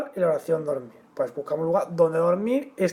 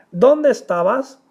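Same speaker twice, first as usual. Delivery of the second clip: "Dónde estabas" slow and louder, as a question; direct question; 4 semitones higher, 6 dB louder, slower than usual. Shown as a demonstration. Teacher demonstrates a direct question to students, questioning. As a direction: ask "Dónde estabas", high and loud on "Dónde". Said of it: "es una pregunta directa".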